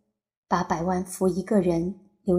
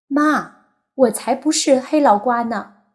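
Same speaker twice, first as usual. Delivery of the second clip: put-on high, loud little boy's protest; a small son answering his mother, high-pitched; young boy insisting, pitched up and loud; childish voice; 5 semitones higher, 7 dB louder than usual. A narrator's reading. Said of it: "自己稚嫩的声音".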